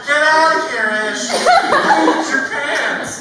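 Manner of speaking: put-on high loud taunting kid voices, laughing; mocking classmates. Classmates taunting Ish, high loud laughing mockery. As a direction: jeer like gleeful first graders. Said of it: "And everyone's like".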